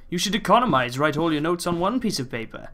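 usual speaking voice

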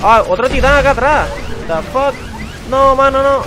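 high, loud startled cry; alarmed shout. Real, not acted. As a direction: yell in sudden alarm. Ambushed by titan. (grito alarmado y fuerte)

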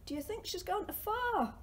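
ordinary talk, nothing marked

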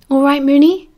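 mildly concerned question, rising at the end; a bit worried. A narrator's reading. Sirius checking on silent Remus at bedtime, a bit worried. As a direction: check on a friend with a touch of worry. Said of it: "He sounded a bit worried now".

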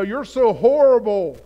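loud, emphatic accusation; Satan. Acted. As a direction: condemn them loudly, leaning hard on horrible. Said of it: "That's how he tries to start imprisoning you".

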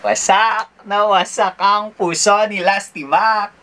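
mock dramatic. Dramatic line about her broken heart.

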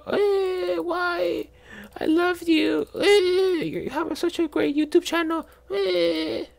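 put-on very high falsetto pleading girlfriend; mock girlfriend voice. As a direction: squeak it out like a clingy girlfriend begging. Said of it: "And then she's gonna say".

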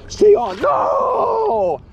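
loud high yell as the fish slips; desperate shout. Real, not acted. Fish about to escape.

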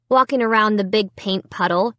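ordinary delivery

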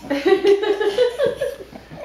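high giggling burst; quick giggle fit; real laughter. Real, not acted. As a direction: crack up. Breaks up mid reading.

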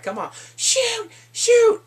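high, slow, drawn-out goading; hammed-up high-pitched taunt; mock daring yell. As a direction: dare them at the top of the voice. Retold dare to shoot.

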